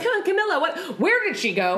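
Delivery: high, puzzled calling out; startled searching. Retold or imitated. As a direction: act out suddenly losing track of a friend. Acting out losing track of friend.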